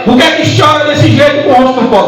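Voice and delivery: high-pitched, intrusive question; acted busybody interrupting a prayer; meddling questioner. Acts meddler questioning a worshipper.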